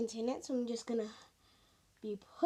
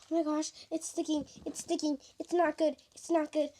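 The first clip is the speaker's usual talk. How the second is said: usual speaking voice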